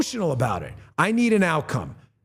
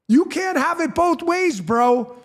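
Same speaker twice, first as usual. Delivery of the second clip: scornful retort; 11 semitones higher, slower than usual. Real, not acted.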